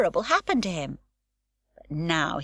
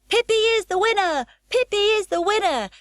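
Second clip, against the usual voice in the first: high, loud chant of the winner; crowd shout; 6 dB louder than usual. A narrator's reading. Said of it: "shouted all the people".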